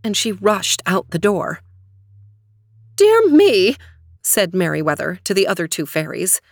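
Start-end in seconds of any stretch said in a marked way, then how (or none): 3.0-3.8 high, louder exclamation of dismay; dismayed fairy. A narrator's reading. Fairy aunt after Aurora rushes out, dismayed. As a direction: exclaim it, taken aback.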